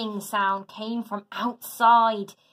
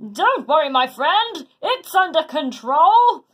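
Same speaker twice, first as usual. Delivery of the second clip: confident hero; 5 semitones higher, 7 dB louder than usual. A narrator's reading. Hercules reassures Tim.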